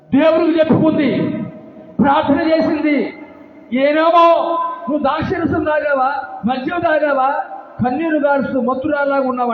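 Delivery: high, loud preaching voice; preacher at full force retelling the priest's charge; fiery preaching. Real, not acted. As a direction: preach it out with fire.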